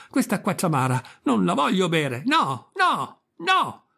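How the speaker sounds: rising to a high wailing no; tearful outburst. A narrator's reading. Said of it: "dando in uno scoppio di pianto".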